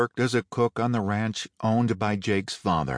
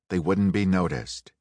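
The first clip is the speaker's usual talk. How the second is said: plain reading voice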